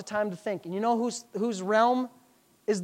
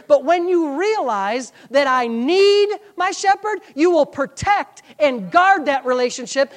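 forceful, building sermon cadence; impassioned preaching; 6 semitones higher, 8 dB louder, slower than usual. Acted. Preacher urging church to guard the shepherd relationship, impassioned preaching. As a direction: press each phrase home to the congregation.

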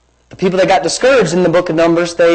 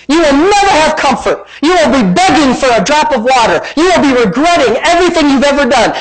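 high, pounding preacher's condemnation; talking back to devil; 6 semitones higher than usual. Acted. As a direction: hurl each verdict at the devil, pitched high. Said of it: "Talk back to him".

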